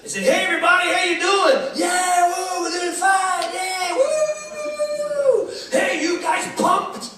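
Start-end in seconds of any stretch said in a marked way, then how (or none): 0.2-1.6 high, loud hype-man greeting; cruise director. Retold or imitated. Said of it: "the cruise director". 1.8-5.5 high, drawn-out whooping reply; cheering crowd. Retold or imitated. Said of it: "All the crowd are going". 5.7-7.1 high, slow hype-man call, stretched out; cruise director. Retold or imitated. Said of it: "the cruise director".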